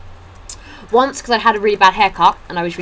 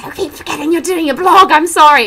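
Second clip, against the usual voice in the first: higher, louder apologetic friend; imitating friend; 9 semitones higher, 6 dB louder than usual. Imitating her flatmate's apology, higher and louder. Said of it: "She's now doing this".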